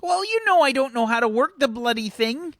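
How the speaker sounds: high, loud mock-exasperated mum. Mum admitting to Arthur she can't work the phone, high voice. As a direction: huff like a mother who can't work phones.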